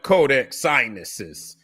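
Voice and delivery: "Codex Sinuses" said slowly, Sinaiticus deliberately mangled into "Sinuses"; mocking mispronunciation. Shown as a demonstration. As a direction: drag out "Codex Sinuses" as a sneering nickname. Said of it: "That's what I call it".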